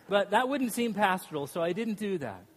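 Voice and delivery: usual speaking voice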